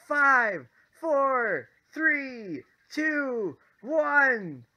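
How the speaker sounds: high, loud calls, each one falling; acted countdown. Rider counting down to the drop, excited calling out. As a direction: call out each number like a launch.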